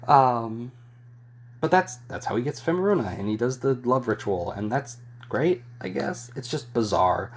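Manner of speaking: usual speaking voice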